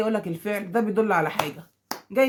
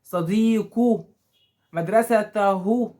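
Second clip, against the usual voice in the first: each word drawn out very slowly; slow dictation; 4 dB louder, much slower than usual. Shown as a demonstration. Teacher to pupils, stretching each pronoun example slowly. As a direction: spell out the endings for the class. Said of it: "الضمير ده مثلًا قول".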